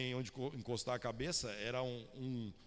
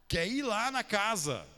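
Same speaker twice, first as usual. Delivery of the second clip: emphatic answer; 8 semitones higher, 8 dB louder, slower than usual. Real, not acted. Preacher answers his own question.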